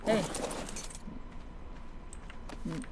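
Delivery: usual speaking voice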